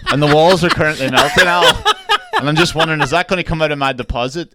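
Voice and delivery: high, loud words through laughter; laughing retelling. Retold or imitated. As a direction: barely hold it together while asking in earnest. Retells absurd phone call, laughing.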